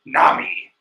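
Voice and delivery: low gravel-edged Snake impression, half whispered; Solid Snake voice. Luffy asking Nami to fly, done as Solid Snake. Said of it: "Luffy is voiced by Solid Snake".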